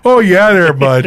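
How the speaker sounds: high, hammed-up Minnesota accent. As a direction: chirp it like a caricature Minnesotan. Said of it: "after doing Minnesota this summer".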